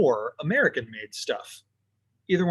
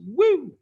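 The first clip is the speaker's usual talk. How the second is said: a high, loud gleeful woo; quick delighted whoop; excited whoop; 8 dB louder than usual. Real, not acted. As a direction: cheer it out like a fan. Excited whoop about crazy scarves.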